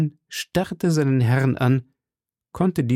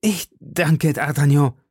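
raised, overjoyed cry of thanks; almost deliriously; 5 semitones higher, 3 dB louder than usual. A narrator's reading. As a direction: thank a friend, nearly mad with joy. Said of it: "rief Aramis fast wahnwitzig". (fast wahnwitzig vor Freude)

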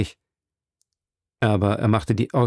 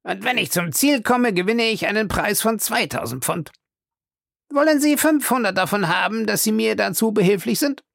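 higher, coaxing detective; voiced detective Fix; 10 semitones higher than usual. A narrator's reading. Detective Fix offering Passepartout money, higher coaxing voice. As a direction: tempt him with a bribe, voice lifted.

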